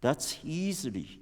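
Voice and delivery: normal talking voice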